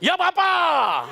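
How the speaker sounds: high, slow drawn-out shout; slow high shouted reply. Real, not acted. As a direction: call it out long and loud. Shouted answer.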